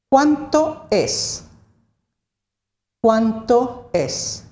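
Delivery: "Cuánto es" high and at half speed, words apart; slow model phrase. Shown as a demonstration. Teacher models question for learners.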